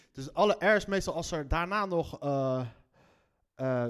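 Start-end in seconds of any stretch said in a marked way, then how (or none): none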